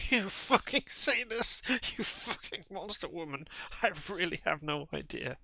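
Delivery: high, slow howl of pain and rage; howling victim. Acted. Injured man howling abuse at his torturer. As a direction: wail the insults like a man in agony.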